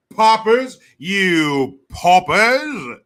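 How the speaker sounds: loud, drawn-out haughty scorn; haughty mockery. Mocking rulers sneering at the poor, loud haughty scorn. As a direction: stretch the words out with grand disdain.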